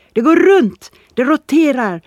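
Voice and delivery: loud, high triumphant shout; excited call. A narrator's reading. Boy bursts in to announce it rotates, excited shout. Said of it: "slängde Boy upp dörren till salongen och ropade".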